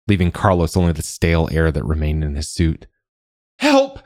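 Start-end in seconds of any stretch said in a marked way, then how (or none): 3.6-4.0 high-pitched cry for help; screamed plea. A narrator's reading. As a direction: scream for rescue, voice leaping high. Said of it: "Miguel screamed".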